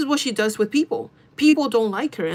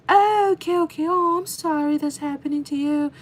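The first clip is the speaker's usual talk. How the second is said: mock coworker voice, pitched high; fake sympathy; 7 semitones higher than usual. Coworker consoling the one who is leaving, fake sympathy. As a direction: lay on phony high-voiced pity. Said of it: "That was the fakest reaction".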